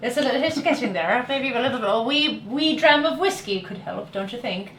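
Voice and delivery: playful Irish lilt drifting toward British; amateur Irish accent, slipping into British; attempted Irish accent. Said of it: "might be going into British".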